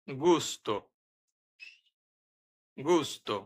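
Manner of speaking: গুস্তো with a hard G; Italian word demo. Shown as a demonstration. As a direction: model গুস্তো carefully and softly. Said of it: "উদাহরণ".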